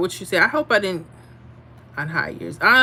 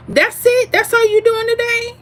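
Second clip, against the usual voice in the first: high, loud mock surprise at a viewer's comment; 14 semitones higher, 4 dB louder than usual. Real, not acted. Teasing a viewer about her short comment, high mock surprise. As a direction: pitch way up, teasing disbelief.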